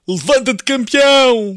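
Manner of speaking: high, loud mock rallying yell; shouted wake-up call. Customer imitating the morning wake-up call, shouting. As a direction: imitate a pushy wake-up shout, pitch climbing. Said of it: "vocês ligaram às seis da manhã a gritar".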